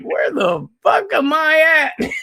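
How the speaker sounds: just woke from anesthesia, lost; groggy bewildered wake-up. Retold or imitated. Patient waking from anesthesia asks nurses, high bewildered squeal.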